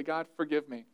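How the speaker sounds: soft, low plea for forgiveness; quiet prayer. Shown as a demonstration. Pastor shows how to pray after failing, softly. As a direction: murmur it humbly. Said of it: "after you've failed and say".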